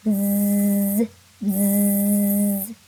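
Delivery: steady buzzing bzz; bee buzz. Shown as a demonstration. Teacher buzzing like a bee for the class. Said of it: "Here comes the bee one".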